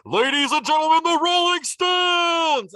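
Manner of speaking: booming, drawn-out stadium announcer call; announcer shout. Retold or imitated. Announcing the Rolling Stones to the crowd, big booming call. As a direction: belt it out like a stadium MC.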